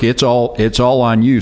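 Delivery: normal talking voice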